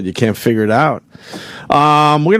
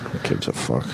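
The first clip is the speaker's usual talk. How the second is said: dismissive; 11 semitones lower, 9 dB quieter than usual. Real, not acted. Dismissive mutter after correction.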